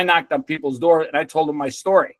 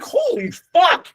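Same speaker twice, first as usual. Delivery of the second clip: much higher and slow, drawn-out astonishment; stunned awe; much slower than usual. Retold or imitated. Kid realizing the bike is within reach, slow high awe. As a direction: let it out in slow amazement, way up high. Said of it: "And I was like".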